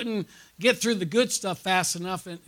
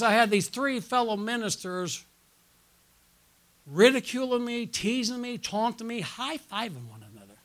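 ordinary talk, nothing marked

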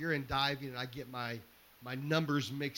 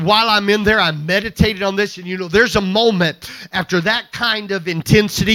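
loud, high, driving sermon delivery; preaching at full force; 5 semitones higher, 18 dB louder than usual. Acted. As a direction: build the story into a shouted climax for the congregation. Pastor preaching a rescue story.

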